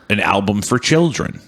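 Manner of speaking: ordinary talk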